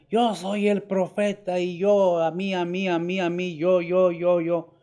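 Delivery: hammed-up boasting false prophet; mock false prophet. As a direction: puff yourself up like a fake prophet. Mocking a self-proclaimed false prophet.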